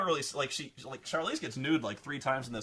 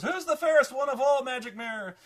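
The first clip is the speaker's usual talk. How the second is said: much higher, louder, hammed-up queen asking her mirror; mocking high queen voice; 12 semitones higher, 7 dB louder than usual. Reviewer mocks the queen asking her magic mirror, high and loud. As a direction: squeal it like a vain queen.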